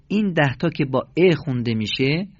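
usual speaking voice

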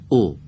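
«او» as a short Arabic u; short Arabic damma. Shown as a demonstration. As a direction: say «او» alone, brief, with an Arabic vowel. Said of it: "دقیقاً به لهجهٔ عربی". («او» با ضمهٔ کوتاه به لهجهٔ عربی)

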